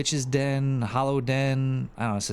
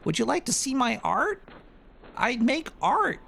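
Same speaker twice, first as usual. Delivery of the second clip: put-on very high sing-song girly voice; hammed-up high girly voice offering art; mock anime girl. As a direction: play the eager fake anime girl. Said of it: "They're like".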